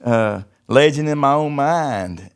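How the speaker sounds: mocking his old self as a boastful showman; showman bravado. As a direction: sell the big show like a boastful star. Acting bull-riding showman fantasy.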